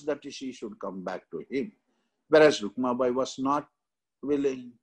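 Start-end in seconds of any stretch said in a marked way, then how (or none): none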